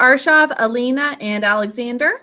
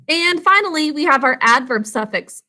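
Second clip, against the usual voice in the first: ordinary talk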